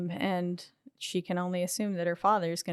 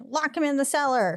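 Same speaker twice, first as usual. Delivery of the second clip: higher-pitched acted command; mock urgent order; 7 semitones higher, 3 dB louder than usual. Retelling the group deciding what to do with him, mock urgent. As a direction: lightly imitate a panicked group's order.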